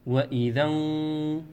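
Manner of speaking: وإذا ان- with the noon hidden into the qaf, no long alif; nasal hidden noon. Shown as a demonstration. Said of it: "لا نقول وإذا". (إخفاء النون)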